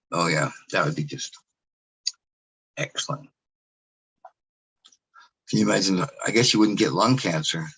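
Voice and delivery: dry, deadpan sarcasm; sarcastic. Acted. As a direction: say it with obvious sarcasm. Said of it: "Sarcasm, can you smell it?".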